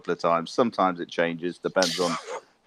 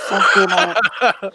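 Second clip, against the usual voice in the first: much higher, louder, stretched-out yell with a laugh; drunk fan yelling; 13 semitones higher, 7 dB louder, much slower than usual. Retold or imitated. Retelling the hockey fight to the host, a slow laughing yell. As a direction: roar it like a rowdy fan at the glass. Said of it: "just bashing on the glass".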